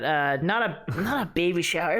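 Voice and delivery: ordinary delivery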